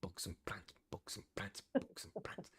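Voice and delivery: whispered beatbox chant; whispered with attitude. Shown as a demonstration. Host testing if bugs works like boots, whispered beatbox. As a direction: whisper it rhythmically, with a bit of attitude. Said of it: "if you whisper it and say it with a little bit of attitude".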